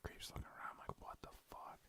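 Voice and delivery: hushed unvoiced whisper. Real, not acted. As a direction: whisper it behind her back. Commenting behind her back.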